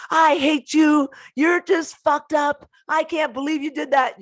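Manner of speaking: loud, high mock drunken fury; hammed-up angry outburst; drunk angry rant. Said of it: "It's like, drunk text".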